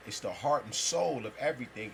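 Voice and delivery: normal talking voice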